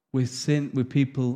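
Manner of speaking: ordinary talk, nothing marked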